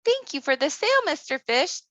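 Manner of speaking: put-on tiny girl thanking her friend; little girl voice. Thumbelina thanks the fish.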